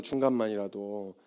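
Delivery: fading to almost nothing, slow; near-whispered echo. Real, not acted. Preacher echoing to the congregation, almost whispered. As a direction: let it die away softly.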